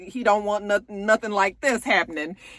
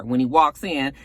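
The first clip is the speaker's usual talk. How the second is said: usual speaking voice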